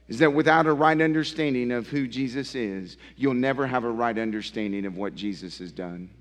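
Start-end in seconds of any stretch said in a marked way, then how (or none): none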